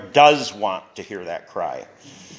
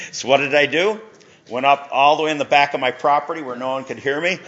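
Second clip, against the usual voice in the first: ordinary delivery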